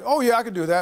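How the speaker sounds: brightly confident promise on the phone; eager yes. Retold or imitated. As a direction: chirp it like a keen yes-man. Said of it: "And I was like".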